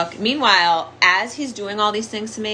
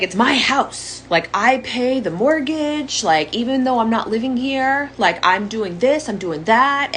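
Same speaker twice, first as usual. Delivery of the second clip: mocking the abuser; 6 semitones higher than usual. Imitating the abuser arguing with her in a mocking higher voice.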